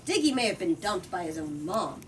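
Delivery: usual speaking voice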